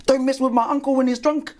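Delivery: high-pitched schoolboy delivering his moral; child's voice for the punchline; Little Johnny. Acted. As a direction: pipe it up like a little kid. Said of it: "okay, little Johnny".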